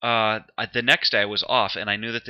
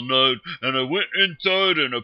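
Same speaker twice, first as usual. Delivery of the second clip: mock average Joe; 5 dB louder than usual. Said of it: "I'm just gonna be an ordinary average Joe".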